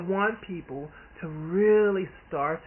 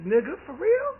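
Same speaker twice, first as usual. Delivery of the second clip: incredulous; 13 semitones higher, 8 dB louder than usual. Retold or imitated. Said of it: "I'm like".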